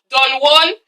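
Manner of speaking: "Don Juan" in a plain English accent; unadorned English-accented "Don Juan". Shown as a demonstration. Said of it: "when I say it in my English accent".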